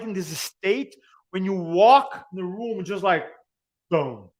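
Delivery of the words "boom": ordinary delivery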